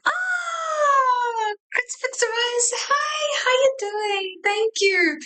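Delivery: high, bright greeting; sing-song hello to viewers; excited greeting. Real, not acted.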